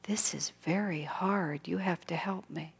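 whispered, barely audible plea; very quiet whisper. Retold or imitated. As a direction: breathe it out in a faint whisper. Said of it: "she said very quietly".

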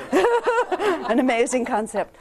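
helpless amusement in the voice; laughing. Real, not acted. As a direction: crack up while getting the words out. Cracks up at own story.

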